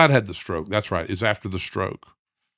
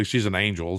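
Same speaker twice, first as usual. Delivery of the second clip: normal talking voice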